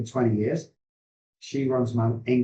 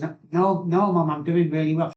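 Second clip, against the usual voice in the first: higher-pitched protest to mom; retold, raised-pitch reassurance; insistent denial; 6 semitones higher, 4 dB louder than usual. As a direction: act out brushing off an accusation. Acts out protesting to his mother.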